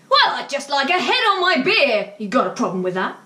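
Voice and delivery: high, loud challenging snap; aggressive retort. Retold or imitated. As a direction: dare her to object. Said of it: "the level of dark aggression in his eyes".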